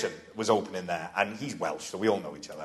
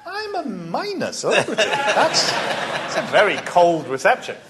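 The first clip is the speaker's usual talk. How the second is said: laughing indignation; 9 semitones higher than usual. Retold or imitated. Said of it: "And I thought".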